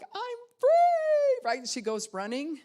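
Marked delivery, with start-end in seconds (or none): 0.0-1.4 much higher, loud ecstatic shout; thrilled squeal of release; joyful cry. Retold or imitated. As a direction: burst out with freedom. Princess escaping the tower.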